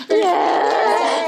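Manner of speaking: a real tearful, breaking yeah; tearful yes from a sister giving a wedding toast, choked up; crying yeah. Real, not acted. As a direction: let the tears break through a high yeah.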